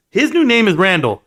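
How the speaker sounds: ordinary talk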